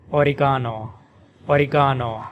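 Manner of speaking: oregano as "o-ri-GAH-no", stress on ga, open ah; British oregano. Shown as a demonstration. Teacher demonstrating oregano in British English, stress on ga. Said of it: "And in British English".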